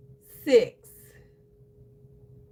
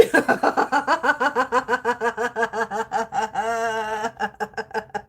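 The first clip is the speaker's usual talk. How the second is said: breathless extended laughter; real helpless laughter; long laughing fit. Real, not acted. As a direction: laugh till you lose it.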